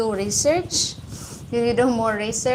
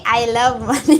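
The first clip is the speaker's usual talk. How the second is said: louder, laughing as she confesses; laughing through it; 3 semitones higher, 8 dB louder than usual. Real, not acted. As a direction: let the laugh break into the joke. Jokes about choosing money.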